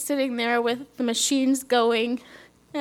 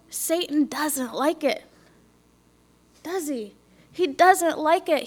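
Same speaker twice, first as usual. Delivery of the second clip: higher-pitched testimony preaching, slowing on key lines; 5 semitones higher than usual. Acted. Testifying to the church about Satan, preaching. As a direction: warn the congregation about Satan's attacks.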